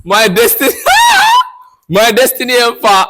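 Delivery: loud laughing shout of my destiny helper; excited hype chant, laughing; shouted hype call. Retold or imitated. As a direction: yell it out like hyping the crowd. Crowd shouting greeting at arrival.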